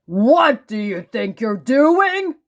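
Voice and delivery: loud, high angry roar; booming angry demand; thundering. A narrator's reading. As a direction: thunder it at him like an angry stranger. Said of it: "he thundered".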